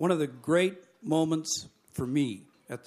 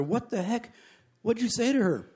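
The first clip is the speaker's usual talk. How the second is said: higher-pitched, incredulous question; acted astonishment; 7 semitones higher than usual. Flight attendants asking the pilot, mildly acted amazement. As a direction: lightly mimic a baffled crew.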